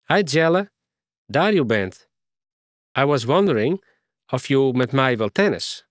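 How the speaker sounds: put-on light English accent in broken Frisian; a foreign girl speaking mixed English and Frisian. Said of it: "Ze praten nogal mooi een accent". (licht Engels accent)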